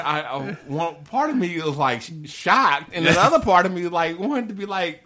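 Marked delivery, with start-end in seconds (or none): none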